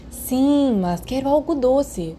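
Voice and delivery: high, pouty little plea; pouting. A narrator's reading. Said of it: "Peter fez um beicinho".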